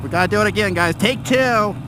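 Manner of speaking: high, slow put-on vlogger announcer voice; motovlogger voice. Rider spoofing motovloggers for his viewers, high slow hammed-up vlogger voice. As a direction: ham it up like a cheesy motorcycle vlogger. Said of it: "I hope you guys like that voice".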